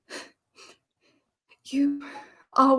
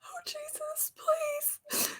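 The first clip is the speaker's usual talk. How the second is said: much softer, whispered through tears; a breathless, tearful whisper of panic; crying whisper; 15 dB quieter than usual. Acted. Winnie whispering in tears after kissing Daniel.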